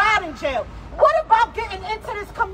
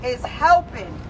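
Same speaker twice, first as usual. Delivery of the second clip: ordinary delivery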